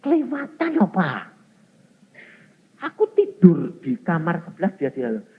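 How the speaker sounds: high, rising outraged retelling; indignant complaint. Retold or imitated. Wife telling the pastor about her husband, high and indignant. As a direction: voice climbing in disbelief.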